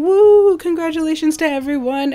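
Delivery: loud high woo, bubbly; excited cheer. Real, not acted. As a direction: cheer for the winners. Cheering the winners.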